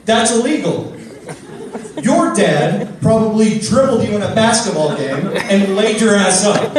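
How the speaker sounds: loud, high, incredulous rant; outraged rant. Retold or imitated. Comic telling off the restroom stranger, loud and outraged. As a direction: blast the stranger for chatting. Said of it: "I go".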